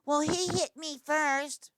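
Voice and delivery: mock a kid making excuses; whiny kid excuse. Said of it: "it's not like".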